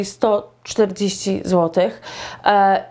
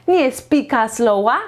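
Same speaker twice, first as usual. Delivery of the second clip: normal talking voice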